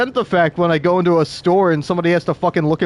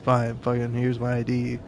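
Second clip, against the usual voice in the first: muttered softer and lower, giving in; resigned mutter; 5 semitones lower, 6 dB quieter than usual. Retold or imitated. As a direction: grudgingly hand it over under your breath. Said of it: "where I'm just like".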